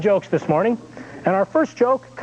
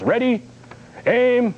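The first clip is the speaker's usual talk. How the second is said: mild pretend firing-squad order; executioner's half-hearted yell in a joke; mock yelled command; 4 semitones higher, slower than usual. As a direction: call out each command separately, only slightly raised. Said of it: "the head executioner yells".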